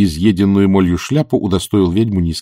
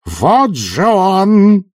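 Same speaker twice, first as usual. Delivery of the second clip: high, long-drawn cry of triumph; triumphant yell; 11 semitones higher, 4 dB louder, much slower than usual. A narrator's reading. Witch points out the culprit on stage, yelling triumphantly. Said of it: "завопила она с торжеством в голосе".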